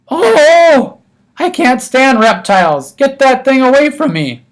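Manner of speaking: a guest recoiling from a turtle, shrieking. A narrator's reading. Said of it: "Missus Yarby shrieked".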